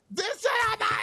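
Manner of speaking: high-pitched retold scream of delight; screamed excitement. Friend recalling reaction to a first voice memo, screaming. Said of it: "like I was screaming".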